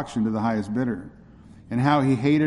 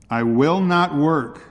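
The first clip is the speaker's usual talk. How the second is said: drawn-out, raised desperate refusal; a slow, louder plea of defiance; desperate cry; 4 semitones higher, 4 dB louder, slower than usual. Acted. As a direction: slave refusing at auction, crying out in desperation. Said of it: "cried Joe in desperation".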